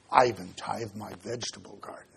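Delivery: low, hushed, quick throwaway boast; muttered aside. Acted. Preacher voices a meticulous tither, muttered aside. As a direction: drop it right down, quick and under the voice.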